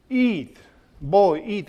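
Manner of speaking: high, friendly coaxing voice; soldier coaxing. Retold or imitated. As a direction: urge the boy kindly to eat. Soldier voiced offering food.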